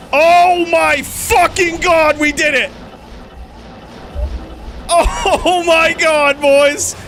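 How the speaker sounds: high, slow screaming joy; giddy relief. Real, not acted. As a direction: yell it out in disbelief. Rare drop finally obtained.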